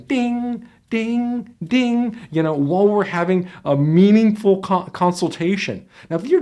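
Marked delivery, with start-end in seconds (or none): 0.1-2.2 high sung "ding, ding, ding" like an email chime; imitated notification chime. Coach imitating email chimes during a consultation, high and sing-song. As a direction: chime like an inbox alert. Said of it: "email chimes coming in".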